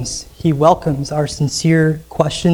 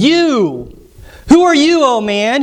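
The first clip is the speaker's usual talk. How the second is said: rebuking God; 8 semitones higher than usual. Acted. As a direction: thunder the rebuke at the upstart. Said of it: "it says".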